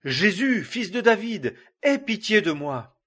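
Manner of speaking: a blind beggar crying out over the crowd. A narrator's reading. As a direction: call out to him, begging for mercy. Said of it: "Et il cria".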